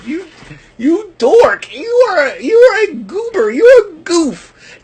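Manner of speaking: high, whiny mock scolding of a dork; mock tearful high-pitched name-calling. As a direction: squeal the insults like a half-crying kid. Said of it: "I'm sitting there going".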